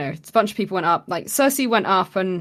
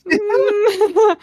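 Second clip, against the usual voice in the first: mock whimper; 8 dB louder than usual. Mock pained reaction in story.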